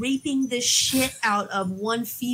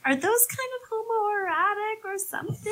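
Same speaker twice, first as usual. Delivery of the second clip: high-pitched questioning voice, softer; mock wondering; 12 semitones higher, 4 dB quieter than usual. Viewer reacting to topless photos, high mock wondering. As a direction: ask it high, as a puzzled onlooker.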